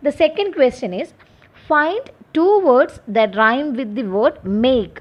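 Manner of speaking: slow, measured reading of an exam question for students; slow dictation reading. Acted. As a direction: read it out slowly, word by word, like dictating to a class.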